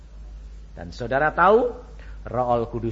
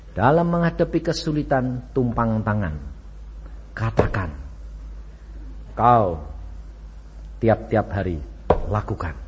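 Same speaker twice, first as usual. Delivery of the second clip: normal talking voice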